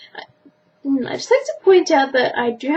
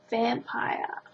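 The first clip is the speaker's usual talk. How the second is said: whispering; 7 dB quieter than usual. Real, not acted. Whispered option.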